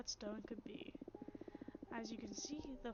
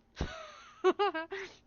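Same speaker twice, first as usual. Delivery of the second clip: loud laugh; 8 semitones higher, 13 dB louder than usual. Real, not acted. Reacting to remembered character.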